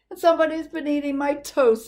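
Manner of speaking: put-on high, fast child voice; little person voice. A narrator's reading. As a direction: chirp it quickly like a small kid.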